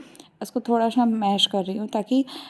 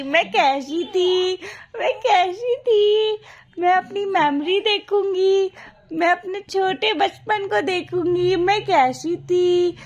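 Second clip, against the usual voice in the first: voicing her baby; 7 semitones higher than usual. Mother speaking for her baby about memories, babyish voice.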